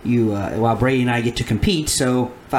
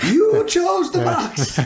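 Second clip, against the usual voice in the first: game show host voice; slower than usual. Pretend. Game show prize joke.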